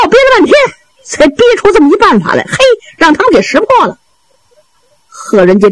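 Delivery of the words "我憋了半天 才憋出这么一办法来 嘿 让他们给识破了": high, vexed muttering to oneself; frustrated inner thought. A narrator's reading. He Renjie frets over his trick being seen through, high and vexed. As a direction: fret aloud in a raised pitch. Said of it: "急得直跺脚啊， 心说".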